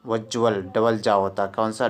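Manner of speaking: ordinary talk, nothing marked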